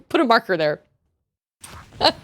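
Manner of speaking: usual speaking voice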